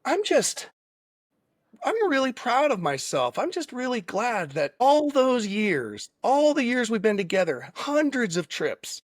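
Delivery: setting her up with playful fake serenity; sarcastic and facetious. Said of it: "sort of being sarcastic and facetious".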